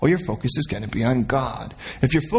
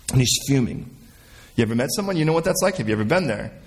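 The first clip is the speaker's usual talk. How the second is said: ordinary talk, nothing marked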